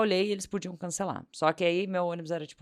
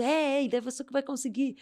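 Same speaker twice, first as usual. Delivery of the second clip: eager passengers chorus; 9 semitones higher than usual. Retold or imitated.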